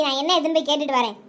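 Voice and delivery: ordinary talk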